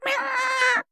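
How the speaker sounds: high-pitched kitten mew; plaintive meow. A narrator's reading. Skeleton cat calling from a hat, plaintive mew. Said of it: "раздалось жалобное".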